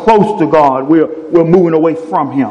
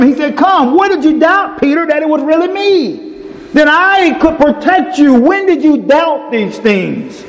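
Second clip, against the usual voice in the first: high, ringing preacher voice acting out Jesus and Peter; preaching at full force; 10 semitones higher than usual. Preacher retelling Peter on the water, fervent and high.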